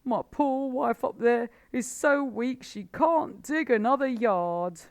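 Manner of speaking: high, loud, frantic old badger; a worn-out badger begging for help; desperate Badger. A narrator's reading. Badger laments.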